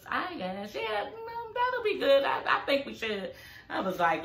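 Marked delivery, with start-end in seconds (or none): 0.1-0.7 repeated softer, fading; reluctant, fading repeat; trailing off. Retold or imitated. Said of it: "she all slow to answer". 0.7-3.3 squeaky, hesitant agreement, then softer; coy and unsure, pitched way up; imitating a coy, reluctant yes; shy reluctant yes. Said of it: "she all slow to answer".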